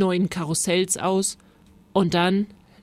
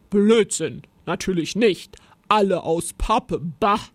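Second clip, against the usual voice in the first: gruff, dismissive creature voice; scornful monster; 4 dB louder than usual. A narrator's reading. Monster answering Rosa about cardboard figures, gruffly scornful. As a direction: scoff in a monster's growl. Monster scoffs at Rosa's question.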